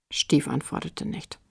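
ordinary delivery, nothing marked

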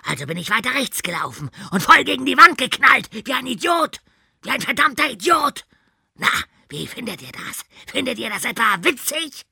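high, loud furious coach; furious shout. Acted. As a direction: bellow in humiliated rage. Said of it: "Er brüllte einfach weiter".

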